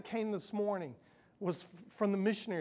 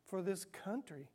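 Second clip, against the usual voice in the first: retold prayer; 8 dB quieter than usual. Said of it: "And I just said".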